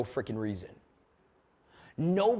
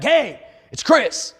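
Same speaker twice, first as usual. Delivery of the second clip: slow, loud put-on caller greeting; a hint of a put-on phone voice; deeper voice; 7 semitones higher, 10 dB louder, much slower than usual. Shown as a demonstration. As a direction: stretch the hello out like a pro caller. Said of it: "I try to do a deeper voice".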